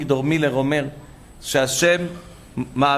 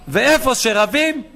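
scolding; 8 semitones higher, 4 dB louder, slower than usual. Retold or imitated. Rabbi scolding in retold story.

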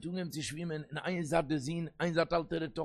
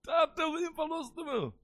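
high-pitched cry of a daughter to her father; imitated desperate scream; 11 semitones higher than usual. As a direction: act out a pleading scream, pitched far up. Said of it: "און זי גייט מיר שרייט".

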